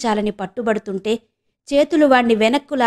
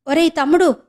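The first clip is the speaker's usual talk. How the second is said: calling out; 6 semitones higher than usual. Acted. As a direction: cry out to stop him leaving. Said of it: "గట్టిగా అరిచి".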